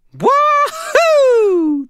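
very high, loud whoop; gleeful whoop. A narrator's reading. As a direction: whoop with wild delight.

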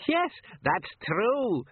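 a lightly voiced cackling crone, slow; slow, high old woman cackle. A narrator's reading. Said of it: "cackled the old woman".

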